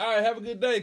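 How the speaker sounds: high, bright quick goodbye; breezy send-off; cheery. Retold or imitated. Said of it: "he was like".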